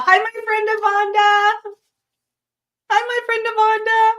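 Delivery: high, loud, delighted hello; high sing-song greeting. Real, not acted. Greeting a viewer in the live chat, high and singing.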